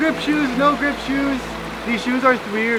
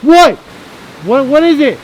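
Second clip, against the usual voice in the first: alarmed shout; 3 semitones higher, 9 dB louder than usual. Real, not acted. Friend cries out suddenly.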